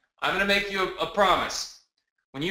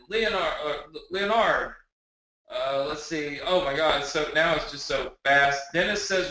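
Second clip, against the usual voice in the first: usual speaking voice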